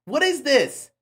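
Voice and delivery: ordinary talk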